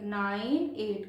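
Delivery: "Nine एट" very slow, digit by digit; one by one. Shown as a demonstration. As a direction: stretch out "nine", then a falling "एट". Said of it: "decimal के बाद एक एक".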